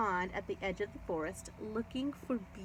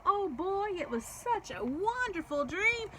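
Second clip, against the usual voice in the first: put-on high, eager little girl voice; gushing girl; 9 semitones higher than usual. A narrator's reading. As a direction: gush about the dream. Gabby gushing about dream.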